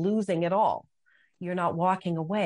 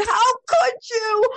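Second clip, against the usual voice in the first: crying, much higher and louder; sobbing wail; 9 dB louder, slower than usual. Retold or imitated. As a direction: break down crying the question at God. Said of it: "walking through the house and just sobbing".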